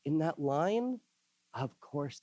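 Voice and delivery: usual speaking voice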